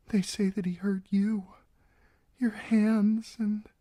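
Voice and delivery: put-on high, girlish and anxious; a frightened young bride trying to sound brave; nervous young bride pleading her case.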